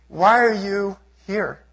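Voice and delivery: slow, drawn-out question; quoting the homeless men slowly. Retold or imitated. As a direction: ask it slowly, one word at a time. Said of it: "They would say".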